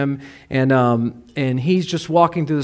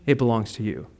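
tender reveal; 4 semitones lower, 9 dB quieter than usual. Retold or imitated. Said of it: "they say something like".

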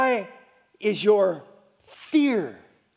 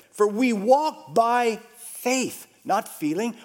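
slow emphatic verse; slower than usual. Acted.

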